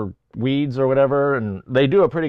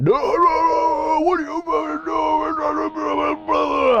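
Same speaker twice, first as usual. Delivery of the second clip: high, slow, strained mock yelling; a high, drawn-out hammed-up shout; 14 semitones higher, much slower than usual. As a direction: squeal it out like a furious neighbor. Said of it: "he'll start yelling".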